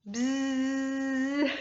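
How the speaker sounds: long steady buzz through the lips; buzzing wasp. Shown as a demonstration. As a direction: hum a mosquito buzz. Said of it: "Can you make a buzzing sound?".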